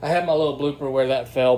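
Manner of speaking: ordinary delivery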